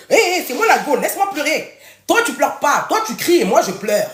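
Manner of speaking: high, loud mock protest; indignant wife. Wife to crying husband, hammed-up indignant protest. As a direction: claim the right to cry, hammed-up.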